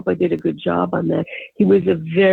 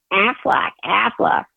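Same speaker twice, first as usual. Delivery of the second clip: higher quacking Aflac Aflac, a touch of a duck; high-pitched duck quack; 4 semitones higher, slower than usual. Retold or imitated. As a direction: squeeze the name out like a quacking duck. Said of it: "in a very high-pitched voice".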